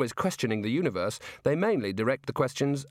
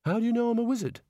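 surprised; 10 semitones higher, faster than usual. Acted. Rincewind startled.

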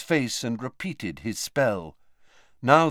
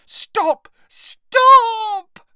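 high, loud shriek, building to a scream; piercing panicked squeal; squealing Moaning Myrtle; 10 dB louder than usual. A narrator's reading. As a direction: scream it higher and longer the second time. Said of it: "squealed Moaning Myrtle".

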